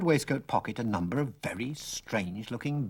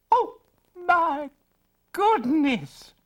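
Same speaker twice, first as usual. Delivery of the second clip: very high gasp of horror; shocked mother; 4 dB louder, much slower than usual. Acted.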